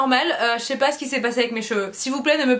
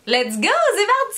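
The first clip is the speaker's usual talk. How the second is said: excited; 7 semitones higher than usual. Real, not acted. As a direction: pitch jumps up, hyped kickoff. Hyped kickoff.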